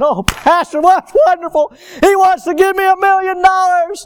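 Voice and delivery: high, loud, hammed-up excitement; mock delight. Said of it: "He's right now saying".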